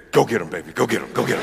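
low voice of the whiskey egging him on; Jack Daniels. Acted. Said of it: "that Jack Daniels said".

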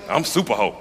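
lightly voiced sassy Superho; defiant Superho. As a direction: rattle it off proudly.

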